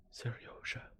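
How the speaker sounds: soft breathy whisper of his name. A narrator's reading. Mother at sleeping son's ear, whispering his name. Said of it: "she repeated just over the child's ear".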